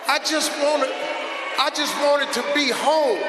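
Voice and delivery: emotional cry. Real, not acted. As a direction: near tears, pushing it out. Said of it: "I almost started crying".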